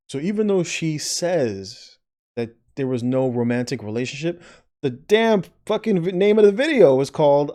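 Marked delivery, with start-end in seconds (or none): 4.8-7.5 loud, high, fed-up rant; loud exasperated commentator; exasperated outburst. Real, not acted. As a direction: blurt it out in real exasperation. Exasperated rant about video title.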